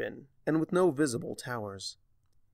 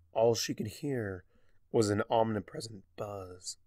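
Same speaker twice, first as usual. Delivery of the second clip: ordinary delivery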